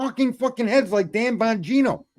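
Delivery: high, exasperated outburst; fed up, fired up. Real, not acted. Host fed up with talking heads, fired-up exasperation. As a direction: burst out as if at the end of your patience. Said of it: "I can't stand it no more".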